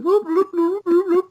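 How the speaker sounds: very high cartoon whooping; imitated whooping. Imitating a cartoon gag for friends, high comic whooping. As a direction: whoop in a silly high put-on voice.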